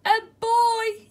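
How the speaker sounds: very loud, high storybook shout; yell. A narrator's reading. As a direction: yell it like an alarmed bear. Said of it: "yelled the bear".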